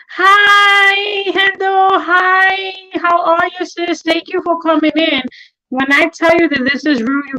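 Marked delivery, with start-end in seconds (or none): 0.1-5.2 high, drawn-level hello to newcomers; welcoming sing-out, pitched up; high greeting voice. Real, not acted. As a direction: break off to greet arrivals in a higher voice. Greeting viewers who join the stream.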